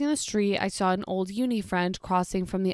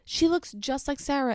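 imitated excited mother, higher pitched; gushing mother; 6 semitones higher than usual. Mother remarking on a lookalike to her daughter, imitated gushing. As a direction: pitch it up like a chatty customer. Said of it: "then the mother was like".

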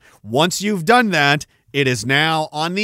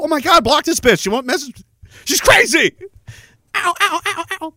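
put-on panicked young guy; 10 semitones higher than usual. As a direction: squeal it like a panicked teenager. Mock panicked young self.

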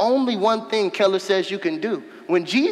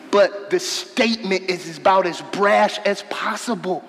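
normal talking voice

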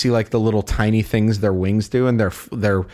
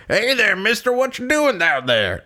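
Arthur Morgan; 9 semitones higher than usual. Acted. Arthur greeting a hobo at the river, cowboy hail. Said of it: "And Arthur goes like".

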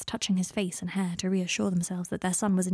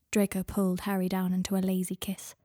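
ordinary delivery

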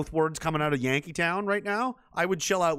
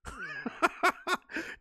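high burst of laughter; real high laugh; high cackle; 4 dB quieter than usual. Real, not acted. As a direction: laugh it off in disbelief. Laughs at poll.

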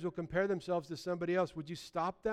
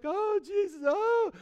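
imitating an overcome worshipper in a high, loud cry; a hammed-up ecstatic worshipper calling out; mock weeping worshipper; 7 dB louder, much slower than usual. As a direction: cry out like someone swept up in worship. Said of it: "they're just like".

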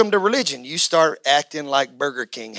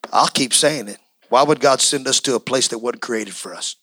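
low, intimate preaching; 5 semitones lower than usual. Acted. Pastor talking gently to his church, low and close. Quiet teaching stretch of sermon.

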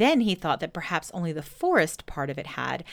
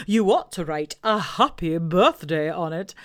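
Owl voice; 5 dB louder than usual. Acted. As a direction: boom it out like a pompous owl. Owl advising.

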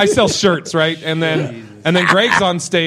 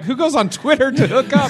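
much higher, disbelieving question; incredulous retort; 8 semitones higher than usual. Retold or imitated. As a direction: toss it back in mock disbelief.

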